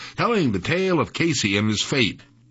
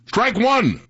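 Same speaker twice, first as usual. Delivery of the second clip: higher, punched umpire's shout; umpire's call; 10 semitones higher, 4 dB louder than usual. A narrator's reading. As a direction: call the strike out loud like an umpire. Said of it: "the umpire said".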